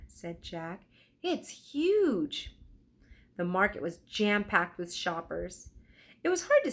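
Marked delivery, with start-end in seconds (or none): 1.2-2.6 awed. Acted. Jack awed by market.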